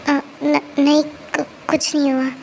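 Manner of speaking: halting, broken-up denial; nervous stammer. Acted. She hides the truth about the child, stammering. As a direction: stumble on first syllables while denying it. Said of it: "तो हकला क्यों रही हो?".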